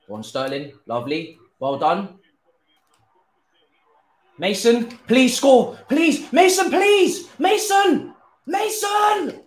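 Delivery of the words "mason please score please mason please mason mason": loud, high begging that builds to a scream; real high-pitched yelling of a name; desperate pleading shout. Real, not acted. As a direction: beg the player to score, getting more frantic. Begging player to score, then missed.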